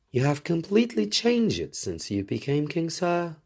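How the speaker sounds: a hint of a princess addressing the king. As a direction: speak as the young princess, politely.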